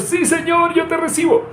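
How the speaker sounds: hammed-up mock prayer in a very high strained voice; fists clenched. Preacher mocks fist-clenching prayer, very high and strained. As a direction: squeak it out like clenching your fists hard. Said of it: "Apretando los puños".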